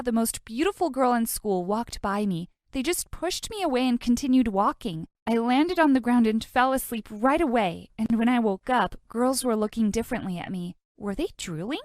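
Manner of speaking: ordinary delivery, nothing marked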